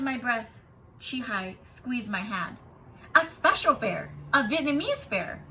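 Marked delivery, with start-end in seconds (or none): 3.1-5.4 exclaiming. A narrator's reading. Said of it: "my teacher exclaimed".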